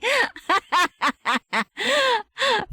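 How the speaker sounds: high-pitched burst of laughter; laughing. Real, not acted. Streamer amused by the character's title, laughing. Laughs after her own reaction.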